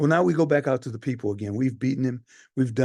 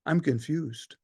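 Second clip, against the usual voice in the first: ordinary talk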